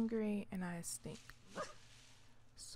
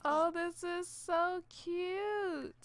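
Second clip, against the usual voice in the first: slow coo; 6 semitones higher, 6 dB louder, much slower than usual. Real, not acted. Cooing at cute pet.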